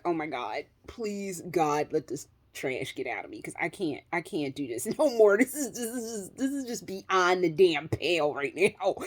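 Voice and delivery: low, hushed plea to God; retold desperate plea. Retold desperate prayer during the week.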